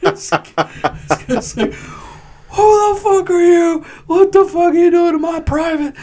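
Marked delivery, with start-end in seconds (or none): 1.7-5.9 furious sergeant. Retold or imitated. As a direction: scream it like a sergeant catching you. Enraged sergeant barging in.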